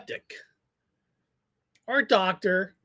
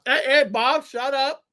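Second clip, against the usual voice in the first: chewing crusty bread; 3 semitones higher than usual. Real, not acted. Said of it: "That's in my mouth".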